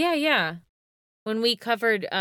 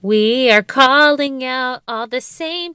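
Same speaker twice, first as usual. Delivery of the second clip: mock announcement; 4 semitones higher, 8 dB louder, slower than usual. Mock announcement calling people out.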